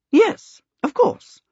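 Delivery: high-pitched princess reply. A narrator's reading. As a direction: jump up high for a princess's ready agreement.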